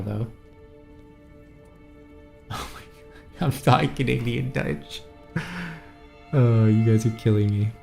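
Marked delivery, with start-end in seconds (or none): none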